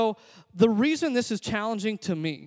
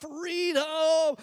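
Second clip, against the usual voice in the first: high, drawn-out imitation of a movie yell; mock battle cry; 9 semitones higher than usual. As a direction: hold back the volume on a mock war cry. Echoes the Braveheart cry again.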